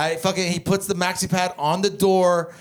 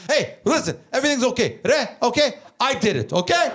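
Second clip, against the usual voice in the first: put-on high, insistent accented dad voice; broken-English father settling a fight, pitched up; dad's foreign accent; 7 semitones higher than usual. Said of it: "my dad can't speak good English".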